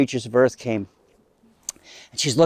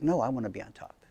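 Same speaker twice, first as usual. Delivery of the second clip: soft, understated acted objection; softly voiced stubborn no; 2 semitones lower, 12 dB quieter, faster than usual. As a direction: refuse quietly, without any push. Adam's first refusal, acted low.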